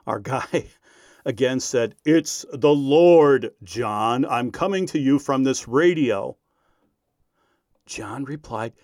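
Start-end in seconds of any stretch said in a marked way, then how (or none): 2.0-6.4 slow, booming opening as a fake God; deep bass voice. Prankster speaks to John as the Lord, deep bass voice. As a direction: proclaim it grandly, stretching the first words. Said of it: "with his deep bass voice".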